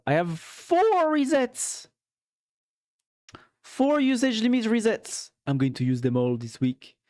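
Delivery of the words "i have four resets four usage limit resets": high, excited exclamation; excited boasting. Real, not acted. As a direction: show it off with a high burst. Showing off how many resets.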